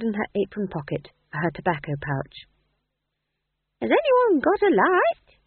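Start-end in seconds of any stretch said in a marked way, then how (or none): 3.8-5.4 very high, loud, slow lilting question; inquiring old woman. A narrator's reading. Old woman wanting a light from the actors, inquiring. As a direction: ask round the cart in a swooping high voice. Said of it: "she inquired".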